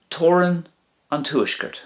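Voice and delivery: "Toran an Tuaisceart" pitched high, "Toran" lifted; bright model. Shown as a demonstration. Teacher modelling the Irish phrase for learners, pitched high. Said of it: "the northern border".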